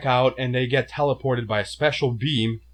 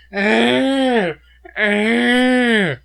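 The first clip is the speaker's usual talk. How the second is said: imitating monster screech; 11 semitones higher, 9 dB louder than usual. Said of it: "Every five minutes".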